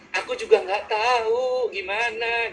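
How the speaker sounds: slow, high mock wail; orang S bingung. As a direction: drag it out like a helpless, tearful friend. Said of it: "Maka S akan jawab". (merengek pelan dan tinggi, pura-pura bingung)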